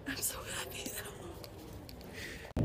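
slow, soft giggling whisper; whispered giggle. Real, not acted. Holding the book she waited a year for, a giggly whisper. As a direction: laugh it out under your breath. Giddy, hushed.

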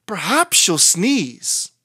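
ordinary delivery, nothing marked

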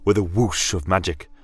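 ordinary delivery, nothing marked

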